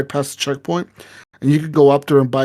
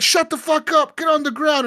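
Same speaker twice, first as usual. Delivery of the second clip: very high-pitched mock cop yell; acted angry order; 12 semitones higher than usual. Retold cop silencing a pleading suspect, high mock-angry yell. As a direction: cut off the pleading with a hammed-up yell.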